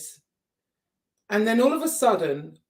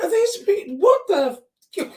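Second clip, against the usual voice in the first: distressed; 11 semitones higher, 3 dB louder than usual. Retold or imitated. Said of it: "And I'm like".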